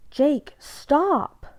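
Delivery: high, loud pleading cry; frightened scream. A narrator's reading. Bella begs Jacob to stop shaking her.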